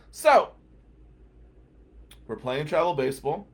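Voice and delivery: ordinary delivery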